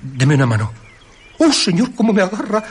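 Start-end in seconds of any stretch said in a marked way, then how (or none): 1.4-2.6 startled cry. Acted. Invisible hand grips him.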